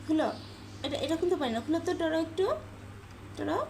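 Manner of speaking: cooing plea to Toro; coaxing pet. Real, not acted. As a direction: ask the pet to help nicely.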